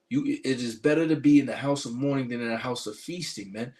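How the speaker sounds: normal talking voice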